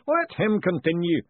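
slow, booming ritual master. A narrator's reading. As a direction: boom it out like a grand master of ceremonies. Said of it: "the booming voice intoned".

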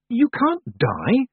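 slow, high shocked protest; drawn-out, high outburst; bursting out. A narrator's reading. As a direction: blurt it in high-pitched disbelief. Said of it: "Tiffany burst out".

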